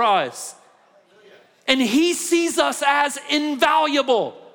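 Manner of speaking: loud, high, impassioned sermon; fiery preaching. Acted. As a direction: preach it out to the whole room. Preaching at full force.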